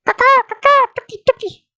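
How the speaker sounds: very high squeaky caw; high silly bird voice; silly bird call. Pretend. Silly addition to the scream.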